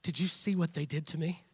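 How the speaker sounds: hushed self-pitying plea, gently imitated; self-pitying complaint. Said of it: "go right to God".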